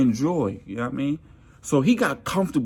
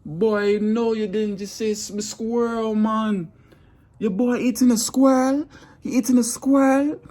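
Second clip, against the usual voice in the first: high, loud mock-Jamaican outrage; Jamaican-accented shocked coworker; 10 semitones higher, 4 dB louder than usual. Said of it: "the Jamaican man like".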